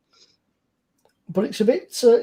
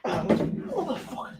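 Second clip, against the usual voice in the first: slow, quieter strained curse under effort; 7 semitones higher, 10 dB quieter, slower than usual. Real, not acted. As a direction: groan it out lifting something heavy. Struggling with heavy item.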